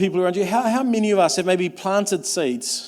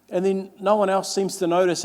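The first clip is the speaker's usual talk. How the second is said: ordinary delivery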